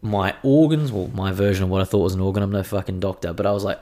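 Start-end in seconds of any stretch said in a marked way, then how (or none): none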